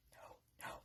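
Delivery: hushed, frantic warning; barely audible imitated plea; pleading whisper. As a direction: whisper it as a secret signal. Kid silently pleads from window.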